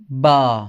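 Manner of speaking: बा said loud with an open a; syllable demo. Shown as a demonstration. Teacher sounding out the syllable for learners. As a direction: stress the open a in बा. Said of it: "क्या sound निकलेगा".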